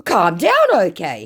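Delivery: high, loud put-on pleading; mock placating scammer. Acts scammer placating her.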